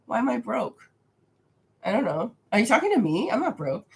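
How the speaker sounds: ordinary delivery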